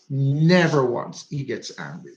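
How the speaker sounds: ordinary delivery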